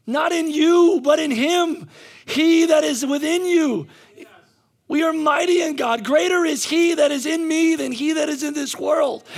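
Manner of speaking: loud, high, impassioned preacher; rousing shouted sermon, key words stretched; full-force preaching. Real, not acted. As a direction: exhort the congregation at full voice.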